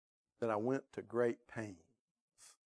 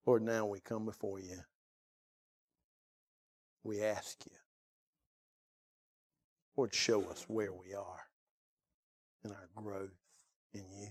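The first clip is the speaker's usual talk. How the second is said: closing prayer; 2 semitones lower than usual. Acted. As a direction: pray over the bowed heads, gently.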